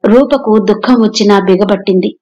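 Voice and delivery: usual speaking voice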